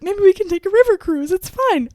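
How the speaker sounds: high, light voice for a retold suggestion; trying to stay upbeat. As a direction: pitch it up, making the best of it. Retelling her attempt to cope.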